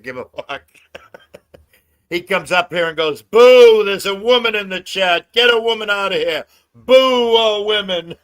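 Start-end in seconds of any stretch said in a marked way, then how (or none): 3.2-8.1 loud, high booing, long on the boos; mock heckling. Tonic booing women in the chat, imitated as loud heckling. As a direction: heckle like a rowdy spectator, in imitation.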